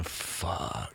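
soft, drawn-out inner curse; dread under the breath. Retold or imitated. Musician recalling his panicked thought, quiet drawn-out curse. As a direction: let the retold thought slip out softly.

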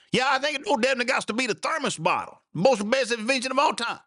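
hammed-up yokel, dead sure; high put-on country voice; old country boy.

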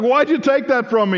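put-on a high, loud protesting toddler; as his two-year-old son, upset the knife is gone; whiny toddler. Toddler voiced by father.

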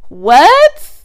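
loud, high disbelieving what; incredulous what. Real, not acted. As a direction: fire it back, stunned. Reacting to absurd quote.

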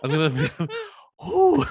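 high, loud laughing gasp; hammed-up breathless gasping; mock out of breath. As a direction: act winded with a laugh. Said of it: "out of breath".

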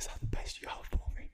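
breathy whisper right into the mic. Acted. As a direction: whisper it close to their ear. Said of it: "You're whispering in their ears".

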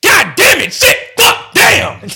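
high, loud burst of swearing; venting curses. Retold or imitated. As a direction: let the frustration out in shouted curses. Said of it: "I just be like".

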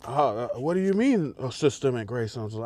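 mock clueless questioning, put-on voice; feigned ignorance. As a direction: play dumb with hesitant, exaggerated puzzlement. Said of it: "They will feign ignorance all day".

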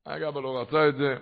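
ordinary talk

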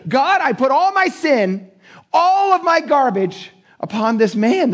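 high, slow, drawn-out cry to God; fervent prayer. Retold or imitated. Preacher voicing a believer's prayer of repentance, fervent declaration. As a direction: stretch the words out in earnest surrender.

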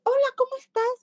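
imita al niño con voz mucho más aguda. Retold or imitated. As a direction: saluda como un niño tímido, con voz muy aguda. Voz del niño que por fin habla. (el niño por fin saluda a su terapeuta, voz infantil muy aguda)

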